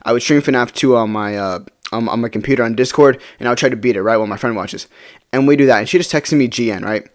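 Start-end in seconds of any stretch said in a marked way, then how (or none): none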